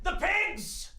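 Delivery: high, trailer-style cry of the title; dramatic announcement. Pretend. Pitching their movie, a dramatic title cry.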